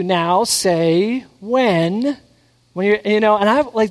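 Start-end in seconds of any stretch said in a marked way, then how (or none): 0.0-2.2 slow drawn out prompt. Retold or imitated. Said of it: "they'll say to you".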